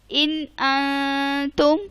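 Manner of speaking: ان انتم recited slowly, the nun of ان sounded clearly; Quranic recitation. Shown as a demonstration. Teacher recites ان انتم slowly for her students. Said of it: "جو اس کا میں نے پہلا نون ادا کیا".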